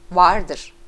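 loud, punched contradiction; insistent shout. A narrator's reading. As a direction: fire it back loudly. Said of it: "Bu son sözleri bağırarak söylüyor". (ısrarla bağırarak)

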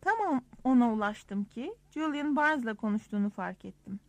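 ordinary delivery

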